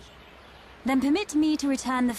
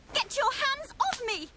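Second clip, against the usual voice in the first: high, alarmed protest; startled outcry. Acted. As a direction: recoil and fend them off in a high voice. Startled, warns off strangers on waking.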